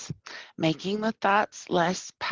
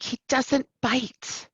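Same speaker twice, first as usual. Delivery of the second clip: normal talking voice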